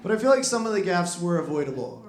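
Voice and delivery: normal talking voice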